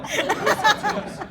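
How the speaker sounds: slow, high, laughing through the question. Real, not acted. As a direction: crack up while asking.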